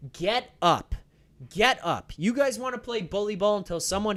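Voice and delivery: loud, emphatic order to stand; exasperated scolding. Acted. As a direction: scold the crybaby player, loudly. Scolding a player lying down.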